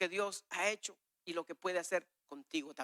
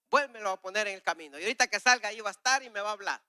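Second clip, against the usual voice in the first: fast, louder, higher pleading; quick, eager bargain with the Lord; hurried prayer; 4 semitones higher, 6 dB louder, faster than usual. Retold or imitated. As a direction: rush the bargain out.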